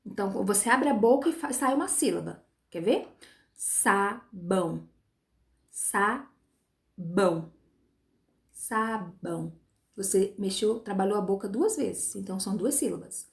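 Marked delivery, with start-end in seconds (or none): 3.5-9.6 "Sabão" very slowly, sa, pause, bão; teacher's drawn-out split of "Sabão"; syllable by syllable. Shown as a demonstration. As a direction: stretch "Sabão" into two separate mouth-openings. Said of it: "a gente fala partes dela".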